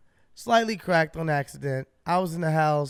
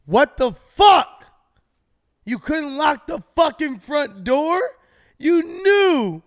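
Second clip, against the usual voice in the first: much higher, much louder, slow furious shouting; furious yelling; 8 semitones higher, 7 dB louder, slower than usual. Retold or imitated. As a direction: yell at your girlfriend for leaving the door open. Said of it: "I'm like".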